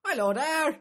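put-on very high, loud Australian accent; mock Australian accent. Comic doing a hammed-up Australian greeting. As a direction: chirp it like a hammed-up Aussie. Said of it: "Scottish one".

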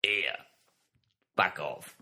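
terse warning in a Cockney deity voice; see them off like an East End bouncer; mock-menacing Cockney Almighty; Cockney God. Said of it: "That's God, that is".

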